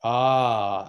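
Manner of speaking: ordinary delivery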